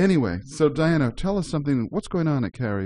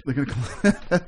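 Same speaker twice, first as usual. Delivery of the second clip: laughing; 3 semitones higher, slower than usual. Real, not acted. Laughs repeating host's joke.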